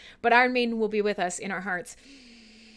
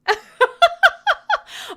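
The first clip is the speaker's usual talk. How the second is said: high giggle; 7 dB louder than usual. Real, not acted. Laughs mid-sentence.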